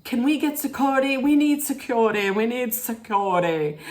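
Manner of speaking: high, put-on British hostess voice; imitated British plea, pitched high; mock British accent. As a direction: play it hammed-up British and fretful. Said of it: "That's when Meredith turned British".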